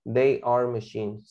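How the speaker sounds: slow, careful model sentence; slow teacher model answer; slow dictation. Shown as a demonstration. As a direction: lay each word down slowly. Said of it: "sí tuve que usar la palabra machines".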